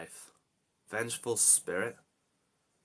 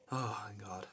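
relieved whisper; 7 dB quieter than usual. Real, not acted. Rescued, relieved.

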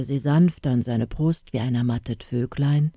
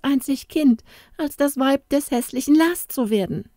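put-on scornful girl; 8 semitones higher than usual. A narrator's reading. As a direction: slam down "nimmer" and sneer at Lars. Inga scorns the suitor.

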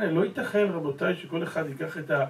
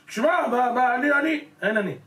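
high, loud self-important bluster; mock arrogance; 7 semitones higher, 4 dB louder than usual. Arrogant man boasting of his status, mock bluster. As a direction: puff up like a boaster.

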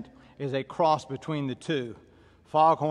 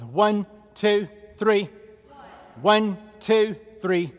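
raised, high steady count; drill cadence; 4 semitones higher, 5 dB louder, much slower than usual. Real, not acted. Trainer counting stationary lunges for class, called cadence. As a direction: call each number out in even rhythm.

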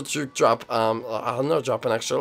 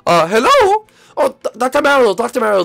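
frantic; 7 semitones higher, 5 dB louder than usual. Acted. Panicked phone call.

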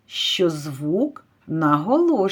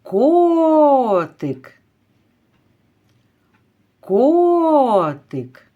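котик called high and loud with a long О; calling out; 6 semitones higher, 4 dB louder, much slower than usual. Shown as a demonstration. Teacher calls the kitty to find the stress, drawing out котик's О. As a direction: stretch the first syllable of котик as a call. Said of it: "Давайте кликати цього котика".

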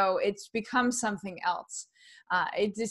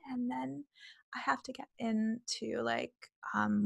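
softer, unvoiced and airy; airy whisper; 2 semitones higher, 10 dB quieter than usual. Shown as a demonstration. Demonstrating her breathy Ivanka Trump voice, whispered and soft. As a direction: breathe the words out on no voice. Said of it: "it's a breathing thing".

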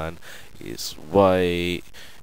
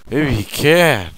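sudden realisation; 5 semitones higher, 5 dB louder than usual. Real, not acted.

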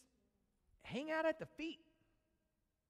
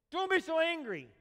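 louder, sharp scolding; scolding parent; 8 semitones higher, 6 dB louder than usual. Retold or imitated. Parent telling their angry kid off, scolding. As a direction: scold a child for being angry. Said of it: "And they say it like that".

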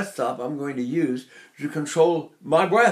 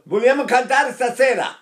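loud, raised-pitch declaimed Italian; imitated Italian speaker; 8 semitones higher, 5 dB louder than usual. Teacher imitates an Italian talking, loud high Italian delivery. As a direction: call it out like an Italian. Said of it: "to an Italian who's saying".

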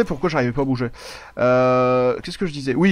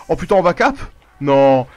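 ordinary delivery

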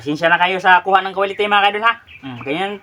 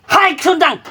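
imitating grandma; 7 semitones higher, slower than usual. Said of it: "Yung aking lola, pag tinatawag ako".

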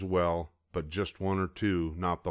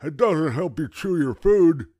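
moaning toothless grandpa; 8 semitones higher, 9 dB louder than usual. A narrator's reading.

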